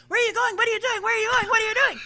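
hammed-up pestering nag on repeat; mock nagging yell. Mimicking someone chasing you to the toilet, mock shouting. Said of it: "yelling at you at the whole way".

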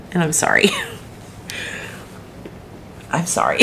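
tearful apology fading to a whisper; real crying. Real, not acted. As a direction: apologise softly through tears. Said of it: "I can't believe I'm gonna cry".

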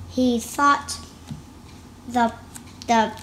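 ordinary delivery

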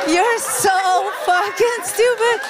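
high, loud, drawn-out retort; mock outburst. Comedian mimics Americans throwing her insult back, mock outburst. Mimics audience's outraged reaction.

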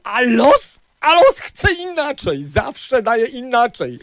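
loud emotional rant. Real, not acted. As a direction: shout out the complaint. Drunk man ranting loudly.

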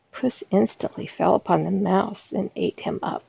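ordinary delivery, nothing marked

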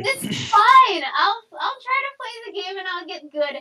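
high, strained fake-cheerful quote; a hint of sarcastic cheer; mock forced calm. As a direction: pretend it's fine through gritted teeth. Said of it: "And so I was like".

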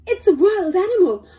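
high, loud frightened cry; panicked doll facing a wild animal. Acted. Dolls scared by a cat.

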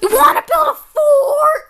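high, shouted cartoonish invitation; hammed-up excitement. Friend inviting Morton to build a fort, excited shout. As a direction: pitch it like the best idea ever.

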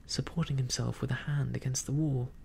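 ordinary delivery

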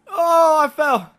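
high, loud cry on falling; dismayed yell. Real, not acted. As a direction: cry out as you suddenly drop. Falls in the game.

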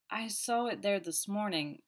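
slow, deliberate recollection; slowly. Acted. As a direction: weigh each word as you recall. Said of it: "I said slowly".

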